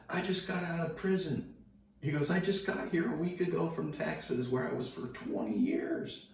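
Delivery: high-pitched, strained mock bragging; retold ex-convict's boast. Ex-convict telling teller he just left prison, high and worked-up. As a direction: imitate a worked-up tough guy.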